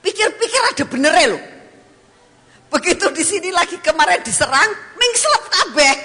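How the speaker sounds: loud, high, laughing sermon delivery; fiery, laughing preacher; preaching at full force. Acted.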